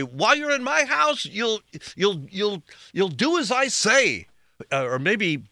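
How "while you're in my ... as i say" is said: high-pitched imitation of a bossy parent; stern parent. Parent asserting house rules to a child, high mock-stern voice. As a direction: lay down the law as a mock parent. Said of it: "one of your parents might say".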